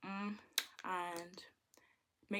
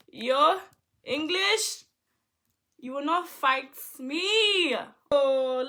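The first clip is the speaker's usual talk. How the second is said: mock shout; 6 semitones higher, 14 dB louder, slower than usual.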